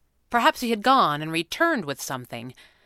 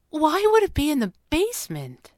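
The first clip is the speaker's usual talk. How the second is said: high, baffled questioning; puzzled, slightly hurt; 10 semitones higher, 4 dB louder than usual. A narrator's reading. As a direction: ask in bewilderment, a touch wounded. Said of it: "had sounded puzzled and perhaps a little bit hurt".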